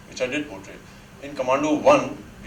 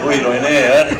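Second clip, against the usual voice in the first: loud, laughing tease; 12 dB louder, much faster than usual. Real, not acted. Actor joking about his two heroines, laughing loudly. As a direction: say it loudly through real laughter.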